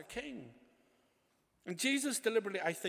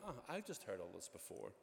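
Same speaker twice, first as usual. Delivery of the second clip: low, quick, softly dismissive; bored inner voice; 9 semitones lower, 8 dB quieter, faster than usual. Acted. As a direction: grumble it quietly to yourself. Bored churchgoer's inward remark acted out.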